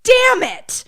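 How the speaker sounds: high, loud curse of a furious dad; retold angry outburst. Retelling a dad's reaction to his son, mock angry outburst. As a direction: blurt it out in a burst of anger. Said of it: "he just goes".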